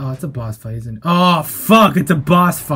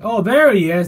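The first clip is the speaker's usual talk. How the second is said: ordinary talk, nothing marked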